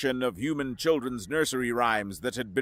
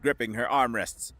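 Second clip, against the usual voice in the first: plain reading voice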